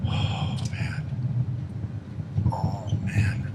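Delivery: ordinary talk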